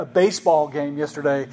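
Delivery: usual speaking voice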